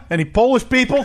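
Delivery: high-pitched call to the house; crowd-work comic. Acted. Comic picking on the audience, high showy call. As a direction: toss it out like a hammy stand-up. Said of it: "it's Broadway comedy".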